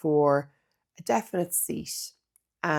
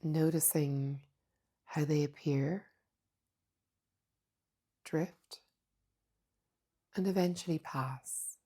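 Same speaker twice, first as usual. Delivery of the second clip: soft, unhurried meditation voice with long pauses; hushed, calm mindfulness guidance; guided meditation; 6 dB quieter than usual. Acted. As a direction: guide them gently inward, leaving space between phrases.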